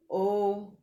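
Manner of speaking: usual speaking voice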